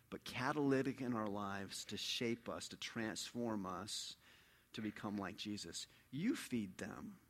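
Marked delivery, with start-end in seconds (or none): 6.1-7.2 slow, soft whisper of "You feed them"; whispered command. Acted. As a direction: breathe "You feed them" slowly, just above silence. Jesus's command said again as a whisper.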